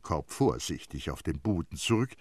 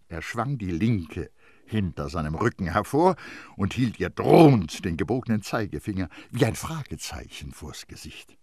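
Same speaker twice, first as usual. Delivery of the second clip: ordinary delivery, nothing marked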